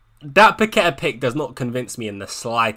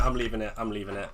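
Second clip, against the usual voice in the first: trailing lower and much softer; fading mutter; 6 semitones lower, 12 dB quieter, faster than usual. Real, not acted. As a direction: let it die away to yourself. Resigning himself, repeating to himself.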